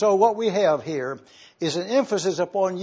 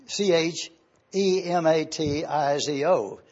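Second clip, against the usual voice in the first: usual speaking voice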